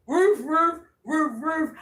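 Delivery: high, loud woof-woof; imitated dog barks. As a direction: bark out two pairs of woofs. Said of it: "Even the dogs is out there".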